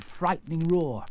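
ordinary delivery, nothing marked

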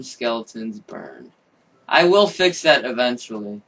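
ordinary talk

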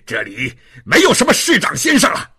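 big loud guffaw into a fast jeer; Javert's mocking laughter. A narrator's reading. Javert sneering at the frightened woman, laughing. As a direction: laugh loudly, then jeer. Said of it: "冉卫哈哈大笑起来".

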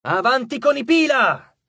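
loud, high, stretched charge order; yelled command. A narrator's reading. Frank ordering the legion forward, yelled. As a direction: send the troops forward with a yell. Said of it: "urlò Frank".

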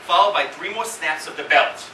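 ordinary delivery

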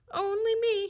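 high, loud outcry; cried protest. A narrator's reading. Dog answering the fox's charge, crying out. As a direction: throw it back in frantic indignation. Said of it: "he cried".

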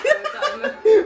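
high, loud laughing words. Real, not acted. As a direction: laugh it out in glee. Laughing excitedly at the scene.

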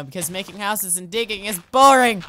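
loud mock outburst, building to the last word; mock complaint. Gamer joking about digging, mock complaint. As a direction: playfully holler how dull it is.

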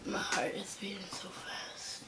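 very soft, whispered confession; frightened whisper. Real, not acted. Scared little brother admitting his fear, whispering. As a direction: whisper it, too scared for full voice.

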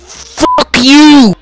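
loud, high mock yell at Thomas. Billy yelling at Thomas, hammed-up loud yell. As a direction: hurl it at him as a rude cartoon engine.

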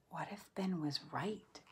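whispered doubt; worried whisper. A narrator's reading. Nate doubting himself alone, in a whisper. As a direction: breathe the fear out to yourself. Nate's anxious inner thought.

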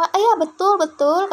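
a hint of a helpful mother agreeing; friendly local lady. Teacher voices a local woman confirming the road, lightly. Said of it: "kata ibu-ibu gitu".

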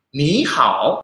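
"Ni hao" joined, "Ni" rising, "hao" dipping; second-then-third tone. Shown as a demonstration. Teacher letting learners hear the changed tone, rising then dipping. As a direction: raise "Ni" like tone two, dip "hao". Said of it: "Ni itu menjadi nada dua".

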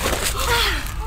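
sharp, high gasp; startled gasp. Acted. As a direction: gasp in sudden alarm. Reacts as Ty is startled.

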